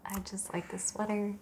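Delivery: whispered aside; a little whispered confession. Real, not acted. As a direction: drop to a whisper about the sweater. Quiet aside about sweater.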